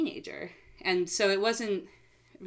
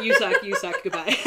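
high, loud mock tirade breaking into laughter; retold yelling; 6 semitones higher, 11 dB louder than usual. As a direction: yell it playfully, ending in a laugh. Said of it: "the awkward position of yelling".

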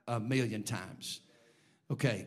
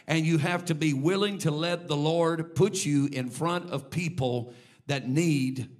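rising, louder preaching build to the congregation; 3 semitones higher, 4 dB louder than usual. Acted. Preacher urging the church to be open, preaching loudly. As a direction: preach it hard, building the appeal.

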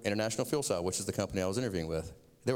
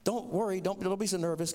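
receptionist voice; 8 semitones higher than usual. Retold or imitated. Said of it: "She goes".

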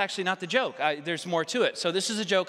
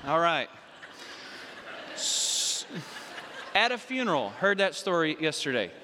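normal talking voice

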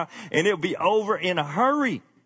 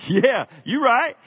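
laughing agreement; 9 semitones higher, 6 dB louder, slower than usual. Real, not acted. Laughing affirmation of translation.

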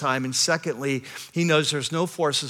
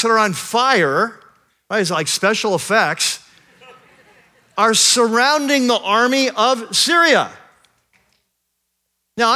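louder, rising sermon build to a climax; preaching at full force; 7 semitones higher, 6 dB louder than usual. Acted. Pastor to his congregation, preaching at full force. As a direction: preach it, lifting the room.

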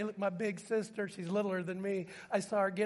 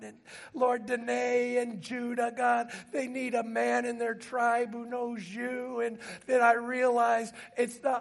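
higher, urgent sermon climax; driving, heartfelt pulpit plea; impassioned preaching; 4 semitones higher, 4 dB louder than usual. Acted. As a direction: preach it with sustained, pleading intensity.

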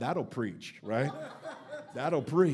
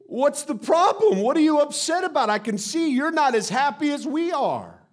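higher and louder, playful dad voice; mock cheerful; 8 semitones higher, 5 dB louder than usual. Father teasing sulking kids, playful and raised. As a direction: tease them with a bright, raised voice. Pastor quoting himself to kids.

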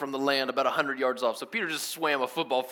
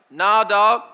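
loud, higher casual callback; raised-voice slangy answer; hollered reply; 6 semitones higher, 13 dB louder than usual. Retold or imitated. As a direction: shout it back to the shore. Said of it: "You're like".

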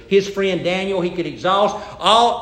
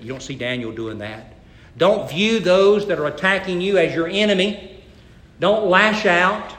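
normal talking voice

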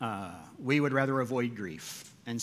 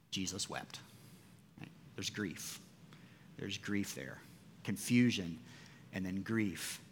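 hushed solemn; 7 semitones lower, 11 dB quieter than usual. Real, not acted. Solemn sermon on weeping.

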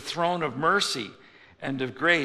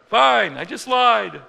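high, loud forced brightness; fake cheerful reply; 9 semitones higher, 11 dB louder, slower than usual. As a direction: sell the lie with bright cheer.

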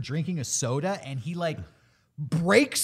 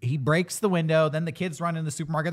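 normal talking voice

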